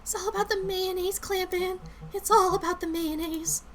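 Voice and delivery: high, hushed character voice; secretive Lindsay. Acted. Coworker confiding a secret to Clampin, high and softened.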